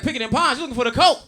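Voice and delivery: high, loud, laughing dealer voice; light up like he just found a customer; a hint of a grinning street dealer; excited dealer. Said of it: "He's like".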